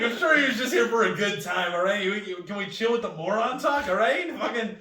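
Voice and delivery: indignant rant. Retold or imitated. Storyteller snapping back at accusing paramedics, mock indignant. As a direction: lay into them with hammed-up indignation.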